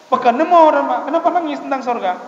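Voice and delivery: high, acted disbelief, loud at first; incredulous questioning. Preacher retells asking why cry about heaven, high and incredulous.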